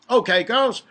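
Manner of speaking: loud, high-pitched rallying call; calling out orders. A narrator's reading. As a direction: address a roomful of workers loudly. Boss calling out to his workers.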